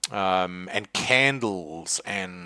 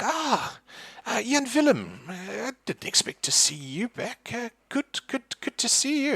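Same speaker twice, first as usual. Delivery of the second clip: put-on much higher old woman's voice, breathy surprise; old lady greeting. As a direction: greet an old friend in a high old voice. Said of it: "she's like".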